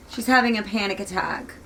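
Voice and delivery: usual speaking voice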